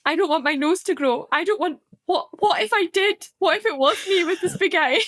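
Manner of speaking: put-on high, worried little-girl voice; fretting child. Mum voicing her daughter fearing her nose will grow, high and anxious. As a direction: plead like a scared toddler. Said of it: "she started being like".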